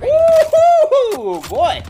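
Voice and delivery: loud, high whoop of joy; excited cheer. Real, not acted. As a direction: cheer it out big. Celebrating a play in game.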